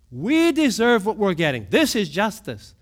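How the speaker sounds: usual speaking voice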